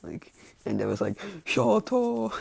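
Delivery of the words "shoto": high, loud exclamation of a name; shocked cry. Acted. Reacting to Shoto found in bed, shocked cry.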